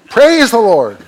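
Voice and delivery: high, loud laughing "Praise the Lord"; laughing exclamation. Real, not acted. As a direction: burst out laughing as you say it. Laughing outburst mid-sermon.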